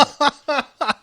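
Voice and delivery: loud, high burst of laughter; laughing at the aunt's dying words; real laugh. Real, not acted. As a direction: break up laughing.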